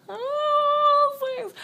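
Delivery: very high, loud drawn-out "oh"; hammed-up high squeal; mock squeal. Reacting before saying thanks, high mock squeal.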